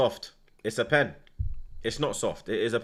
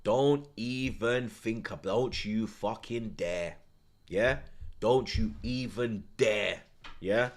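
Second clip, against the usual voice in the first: low warning; 3 semitones lower, 7 dB quieter, much slower than usual. Real, not acted. Warning Arsenal, tense and slow.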